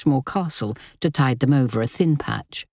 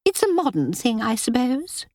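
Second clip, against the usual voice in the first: sulky; 10 semitones higher than usual. Acted. Linda continues sulking.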